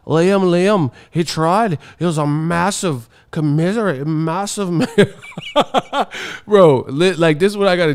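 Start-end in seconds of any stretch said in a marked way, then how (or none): 0.1-6.1 high, hammed-up British accent; mock British fan. British fan consoling Liam, mock British accent. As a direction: parody British sympathy, breaking toward laughter. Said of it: "the British teeth be like".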